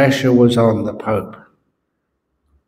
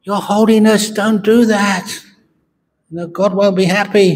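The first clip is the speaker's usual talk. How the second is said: scolding nun; 8 semitones higher than usual. Acted. Nun scolds the Pope again.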